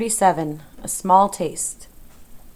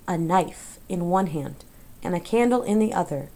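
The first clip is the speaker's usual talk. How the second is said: plain reading voice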